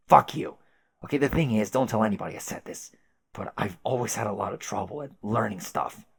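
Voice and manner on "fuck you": loud high angry outburst; furious shout. Acted. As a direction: spit it out in rage. Caliborn insulted about age.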